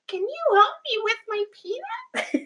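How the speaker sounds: very high, thin imitation of a child; little boy's voice. Student repeating his request to the teacher, very high child voice. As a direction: repeat it in a squeaky little-kid voice. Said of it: "he speaks very quickly and not particularly clearly".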